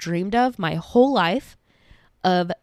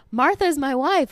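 high, louder, drawn-out announcement; excited reveal; 6 semitones higher, 5 dB louder than usual. Retold or imitated. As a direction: proudly reveal it, pitched up. Retold husband's excited reveal.

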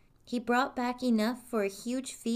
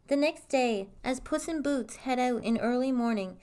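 plain reading voice

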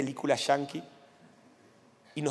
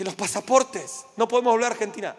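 alarmed; 6 semitones higher, 3 dB louder than usual. Retold or imitated. Panicked husband remembering passports.

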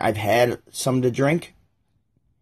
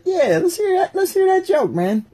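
much higher-pitched, egging him on; hyped group leader; 3 dB louder than usual. Acted. Alpha answers the guy offering a joke, hyped. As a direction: egg the joker on in a high, hyped voice.

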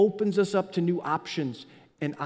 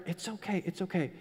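reassuring; 8 dB quieter than usual. Real, not acted. Comforting the congregation.

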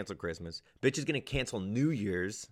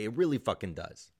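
ordinary talk

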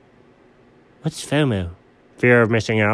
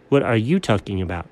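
usual speaking voice

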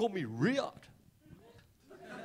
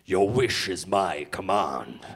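low, slow, drawn-out genie voice; 10 semitones lower, 4 dB louder, slower than usual. Retold or imitated. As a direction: intone it slowly like a genie from a lamp. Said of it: "you rub the lamp and it".

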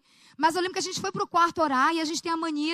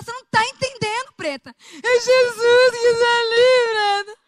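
panicked crying; 7 semitones higher, 8 dB louder than usual. Retold or imitated. Telling her friend Jesus is in the room, loud panicked crying. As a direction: plead in awe through a sob. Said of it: "eu entrei em pânico".

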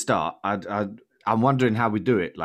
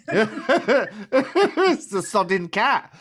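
laughing; 11 semitones higher, 5 dB louder, much slower than usual. Real, not acted. Cat interrupts, host laughing.

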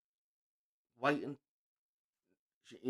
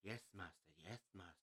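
low, hushed, mock-obedient "yes master"; servile slave; 8 semitones lower, 21 dB quieter than usual. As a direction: mumble it like a beaten-down servant. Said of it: "He might as well have said to her".

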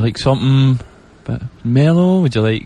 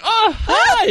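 high, laughing fake cheer; mock surprised. [name] greeting the churchgoers, high laughing surprise. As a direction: squeak out a startled hello. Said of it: "I was like".

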